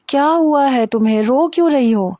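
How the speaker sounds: begging to know why she cries; anxious concern. Acted. Armaghan, shaken, asks crying Hira what's wrong.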